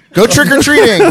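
laughing through the words, high. Real, not acted. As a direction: giggle it out, teasing. Teasing friend to eat bean.

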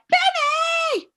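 high, loud shouted name; loud sing-song call of Benny; super loud call. Retold or imitated. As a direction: yell his name across the hall. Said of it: "Like, super loud".

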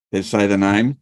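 usual speaking voice

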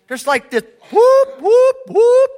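high, loud mock whoops rising to a squeal; whoop noises. Pastor mimicking inner alarm with loud high whoops. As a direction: imitate a siren-like whoop of confusion.

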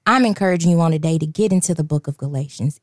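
normal talking voice

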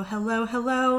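ordinary delivery

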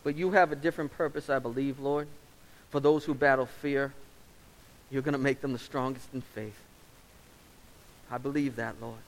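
earnest pastor's prayer, measured and steady; closing prayer. Acted. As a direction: pray over the congregation.

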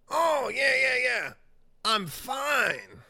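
very high, loud mock holler; addled dealer. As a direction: mimic him hollering that all is well. Imitating addled dealer's reassurance.

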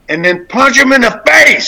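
punchline blurted through a laugh; a joke that ends in a laughing shout. Acted. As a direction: laugh as you land the twist. Punchline of twisted saying.

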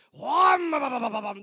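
loud chainsaw roar breaking into a sputter; imitated chainsaw. Man firing up a chainsaw to scare kids, loud imitated roar. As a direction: mimic a chainsaw revving up with your mouth.

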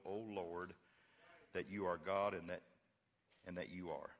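soft, low, earnest prayer; quiet prayer. Retold or imitated. Elijah praying quietly to God before the people. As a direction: pray it gently and humbly. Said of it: "He just prayed".

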